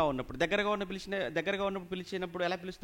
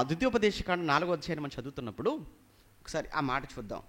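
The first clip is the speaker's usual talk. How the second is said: ordinary talk, nothing marked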